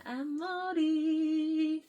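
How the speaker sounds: stretched squeaky character voice; high, slow silly voice. Pretend. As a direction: stretch it out like a cartoon.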